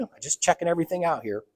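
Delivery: ordinary delivery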